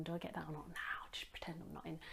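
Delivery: furtive whispered aside; hushed whisper. Real, not acted. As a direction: whisper as if hiding from a caller. Debating whether to answer the door.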